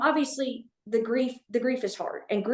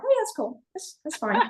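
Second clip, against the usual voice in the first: high-pitched mock nonchalance; casual act, pitched way up; feigned cool; 6 semitones higher than usual. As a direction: play it cool while screaming inside. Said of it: "inside I'm screaming, but it, I'm like".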